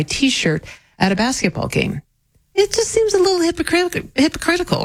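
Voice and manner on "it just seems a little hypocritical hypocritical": mock indignation. Real, not acted. Mock complaint about double standard.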